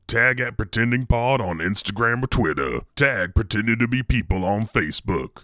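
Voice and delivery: ordinary delivery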